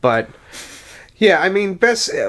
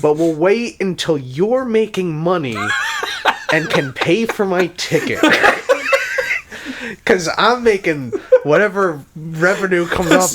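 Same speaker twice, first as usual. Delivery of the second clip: higher-pitched, laughing tease; 7 semitones higher, 3 dB louder than usual. Real, not acted. Teasing co-host about paying for tickets, laughing. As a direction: tease through laughter, voice lifted.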